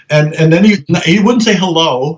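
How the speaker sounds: ordinary talk, nothing marked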